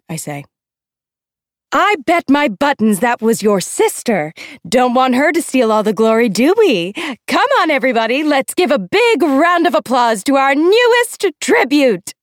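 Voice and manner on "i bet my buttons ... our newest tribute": high, loud, theatrical trill; trilling escort. A narrator's reading. Escort calling for applause, gleefully trilling. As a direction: whip up the crowd in an affected high voice. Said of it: "trills Effie Trinket".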